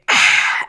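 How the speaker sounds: breathy, voiceless "ah" of satisfaction; satisfied gulp-down sigh. Retold or imitated. Storyteller imitating Kyle after his drink, satisfied sigh. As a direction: exhale like you just finished a meal. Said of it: "as if he just ate the AirPod and is satisfied".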